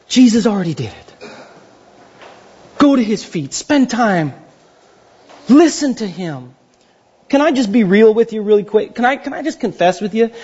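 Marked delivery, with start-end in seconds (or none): none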